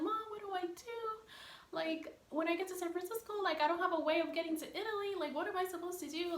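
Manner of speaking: high-pitched mock-tearful pleading; retold crying plea. Daughter crying on the phone to mom, retold tearful plea. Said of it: "crying on the phone".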